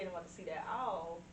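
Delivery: ordinary talk, nothing marked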